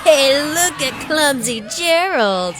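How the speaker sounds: loud, high mocking jeer; sneering taunt. A narrator's reading. Animals sneering at clumsy Gerald, loud mocking jeer. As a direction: jeer at him like a playground bully. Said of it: "the animals all sneered".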